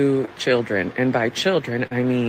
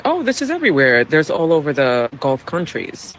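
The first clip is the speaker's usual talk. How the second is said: dismissive locals. Retold or imitated. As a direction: brush it off like it's normal. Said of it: "everyone was telling me".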